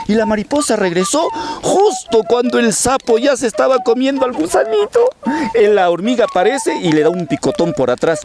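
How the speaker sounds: high, excited narration; suspenseful storytelling. Acted. Storyteller tells children of the last-second rescue, high dramatic narration.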